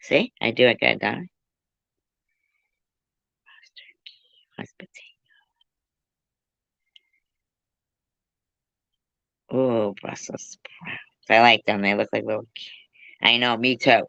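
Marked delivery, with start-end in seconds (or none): 3.5-11.0 slow, breathy whisper. Acted. Whispering food names to friends, slow and hushed. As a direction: hush it like a secret.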